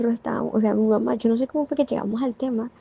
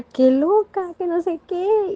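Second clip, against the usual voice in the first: teasing imitation; 8 semitones higher, much slower than usual. Imitating Anita teasing her.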